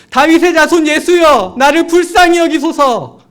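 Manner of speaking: high, loud desperate cry for mercy. Retold or imitated. Blind beggar crying out to Jesus, loud desperate shout. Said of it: "큰 소리로 외치기 시작합니다".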